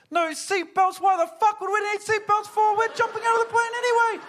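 very high, loud yelled mockery; incredulous shout. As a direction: imitate a skydiving instructor yelling over the plane. Instructor mocking his worried passenger, shouting.

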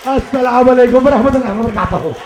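put-on loud, fast Indo-Pakistani accent. Comic plays an Indo-Pakistani brother, loud Indo-Pakistani accent. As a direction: fire off the greeting with Indo-Pakistani rhythm. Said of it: "Indo-Pakistani brothers".

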